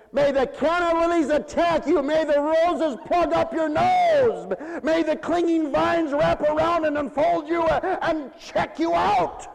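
high, loud and slow mock curse, laughing at the end. Preacher imagining cursing the florist, a hammed-up flower curse. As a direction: play it like a hammed-up hex. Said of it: "I could have said".

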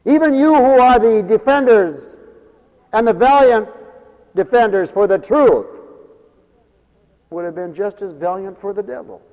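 high, loud preaching climax; fiery preaching. Acted. Preacher proclaiming grace to radio listeners, full force.

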